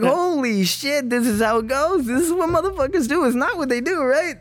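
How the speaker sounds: excited quote. Retold or imitated. Retelling his club epiphany to friends, awed and excited. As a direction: mimic his own amazed thrill.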